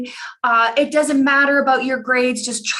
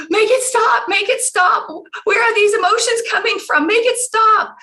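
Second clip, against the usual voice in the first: higher, mock tearful begging; imitated sobbing plea; 10 semitones higher, 4 dB louder than usual. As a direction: plead like a crying daughter overwhelmed. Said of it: "started crying and crying and sobbing".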